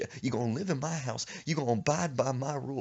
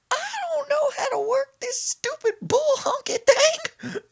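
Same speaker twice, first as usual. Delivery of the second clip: crying shout; 8 dB louder than usual. Retold or imitated.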